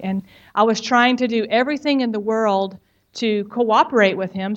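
normal talking voice

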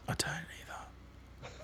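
slow breathy whisper. Real, not acted. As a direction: whisper it back conspiratorially. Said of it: "Why, why are we whispering?".

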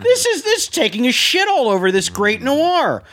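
high-pitched mock indignation; hammed-up offended film fan; retold indignation. Retold outrage at the voiceover.

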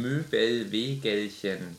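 each syllable spelled out slowly; slow careful. Shown as a demonstration. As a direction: carefully sound out Möbelwegelchen syllable by syllable. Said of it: "Du musst Möbelwegelchen sagen".

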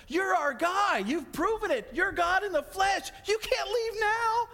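high, pleading disbelief, rising to a cry; disciples' protest. Retold or imitated. A preacher voicing the disciples begging Jesus not to leave, high and pleading. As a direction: beg him not to go, voice up high.

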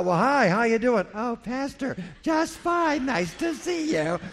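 all smiles for the pastor after fighting; fake church niceness. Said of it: "That's a lie".

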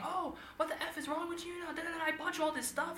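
a laughing, high mock of mom's cursing; mother cursing. Daughter mimics her mother scolding her over a bought gift, high and laughing. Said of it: "She's saying".

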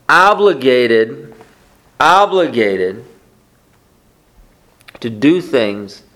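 slow emphasis. Real, not acted. Preacher stresses burden slowly.

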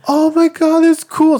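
put-on high, excited kid voice. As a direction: squeal it like an awed child. Kid impressed by him.

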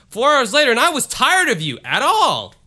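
hammed-up high loud reading; mock dramatic reading. Shown as a demonstration. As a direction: read the typo out like a big announcement. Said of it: "Doesn't make sense".

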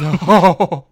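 loud laughing no; laughing shocked denial; incredulous. Real, not acted. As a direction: burst out in amused disbelief. Disbelief at broken toe.

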